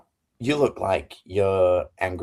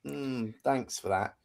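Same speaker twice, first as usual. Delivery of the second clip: mock unimpressed thanks; 3 semitones higher, 6 dB quieter, slower than usual. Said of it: "you're like".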